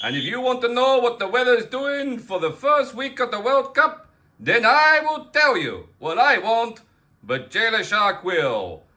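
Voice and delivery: mild Argentine accent, lightly put on; a hint of Maradona's Spanish accent; Maradona impression. Impersonating Maradona for a World Cup weather intro, light Argentine accent. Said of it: "I am Diego Maradona".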